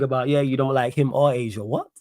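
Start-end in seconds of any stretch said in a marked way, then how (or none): none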